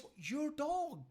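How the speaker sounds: high, drawn-out question; imitated wife's dismay; shocked wife. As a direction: ask slowly in high-pitched disbelief. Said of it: "My wife says".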